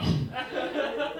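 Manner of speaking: high, giggly chuckle; giggle at own joke. Pretend. Comic giggling at his own notebook joke. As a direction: giggle like you find yourself hilarious.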